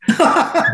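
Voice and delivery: sharp high laugh. Real, not acted. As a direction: burst of laughter at the stuck pedal. Laughs at stuck pedal.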